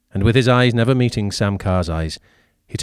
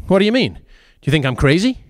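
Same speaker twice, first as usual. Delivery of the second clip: blustering; 8 semitones higher, faster than usual. Acted. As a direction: bluster it out, defensive. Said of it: "Alfred blustered".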